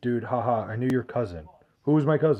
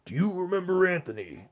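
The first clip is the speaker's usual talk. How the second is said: high, strained wrestler impression; Macho Man Randy Savage; 6 semitones higher than usual. Streamer restarting a chat question, wrestler impression. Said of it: "as Macho Man".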